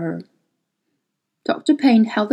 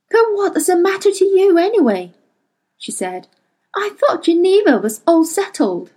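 higher, accusing question; suspicious; 7 semitones higher than usual. Acted. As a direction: demand the truth. Mary's suspicious challenge.

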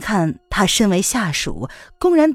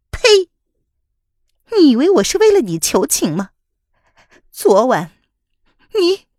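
high, loud furious woman's voice; bitter hatred; 10 semitones higher, 6 dB louder than usual. Acted. Wife scorning her treacherous husband, high and furious. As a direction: spit the words at him. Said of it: "宋飞宇恨恨地说".

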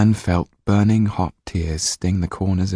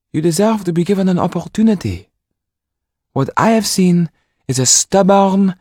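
much higher, louder reproving woman's voice; stern lady; 12 semitones higher, 6 dB louder than usual. A narrator's reading. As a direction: scold him as a stubborn boy. Mrs Laxton lecturing Jan.

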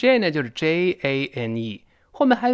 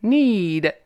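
"Need" high and louder, with long ee; stressed long vowel; 7 semitones higher, 5 dB louder than usual. Shown as a demonstration. Teacher shows the stressed word, long vowel. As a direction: hit Need hard, stretching the vowel. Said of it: "这是重读了".

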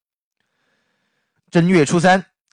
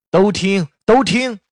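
crowd shouting; 6 semitones higher, slower than usual. A narrator's reading. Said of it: "连声高喊道".

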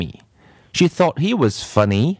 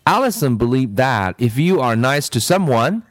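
usual speaking voice

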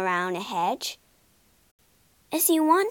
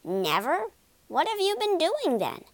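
incredulous, rising then high; amazed questioning without shouting; astonished cry; 6 semitones higher than usual. A narrator's reading. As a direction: ask in disbelief, climbing in pitch. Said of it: "cried Rat".

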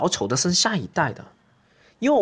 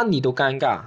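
ordinary talk, nothing marked

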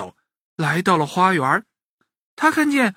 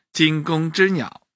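ordinary delivery

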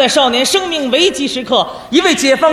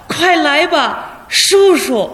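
heartfelt call; 4 semitones higher, much slower than usual. Acted. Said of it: "便由衷地喊道".

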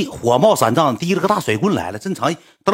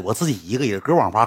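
normal talking voice

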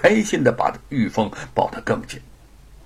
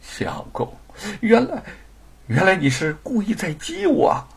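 half laughing half crying; 8 semitones higher than usual. Acted. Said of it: "有些哭笑不得".

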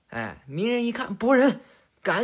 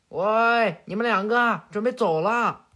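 put-on louder, raised-voice call to two kids; calling back; 2 semitones higher, 4 dB louder than usual. Naruto at the door to his two kids, calling out. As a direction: call out to hurry them along. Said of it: "回过头去喊".

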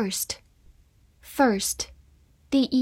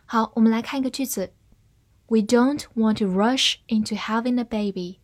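usual speaking voice

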